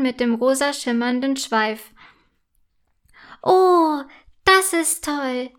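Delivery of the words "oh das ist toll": high, loud "oh", then drawn out; delighted exclamation. A narrator's reading. Unicorn mare cheers news of a foal, delighted. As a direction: exclaim with pleased delight, stretching the words. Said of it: "ruft Wölkchen und wiehert vergnügt".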